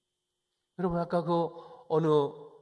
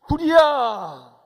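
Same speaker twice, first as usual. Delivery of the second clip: loud, high, drawn-out yell; shouted warning of fire; fire-alarm shout; 9 semitones higher, 15 dB louder, much slower than usual. Acted. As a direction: cry out the alarm, stretching the word. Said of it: "집안에 불이 나기 전에는 큰 소리 치지 말라고".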